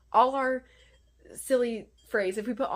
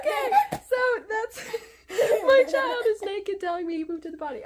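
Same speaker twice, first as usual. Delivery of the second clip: high laughing aside; 13 semitones higher, 8 dB louder than usual. Real, not acted. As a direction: giggle through the words. Laughing at child interruption.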